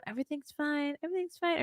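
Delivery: soft, high soothing voice; calm reassurance. Retold or imitated. Parent reassuring a startled baby, soft high calm. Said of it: "I have to be calm".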